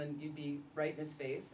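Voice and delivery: ordinary delivery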